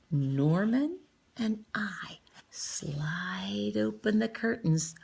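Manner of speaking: slow suspenseful whisper; hushed tension. A narrator's reading. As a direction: creep it out softly.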